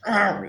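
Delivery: short high-pitched "argh"; mock yell. Retelling the fight in the van, mock yell. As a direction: imitate a sudden yell of attack. Imitates character's yell during struggle.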